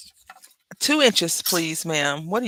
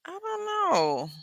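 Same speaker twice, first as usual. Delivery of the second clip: high, slow, hesitant I don't know; unsure reply; 14 semitones higher, 4 dB quieter, slower than usual. Retold or imitated. Answering her uncle about the devil, high and hesitant. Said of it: "I was like".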